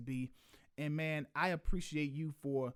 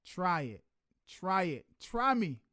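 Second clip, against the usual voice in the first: rising, louder dare; building challenge; 4 semitones higher, 7 dB louder than usual. Real, not acted. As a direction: pump it up to a shout. Defending his friend to audience.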